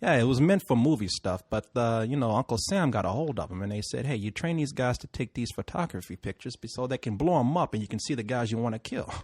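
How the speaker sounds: low, quiet, rambling Puerto Rican cabbie; softly imitated Puerto Rican driver telling his story; bad Puerto Rican accent. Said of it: "I'm gonna do it bad accent".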